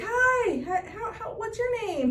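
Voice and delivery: high-pitched imitation of an eager young guy; cute kid. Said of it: "the one really cute kid".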